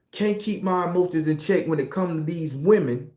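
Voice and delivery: ordinary delivery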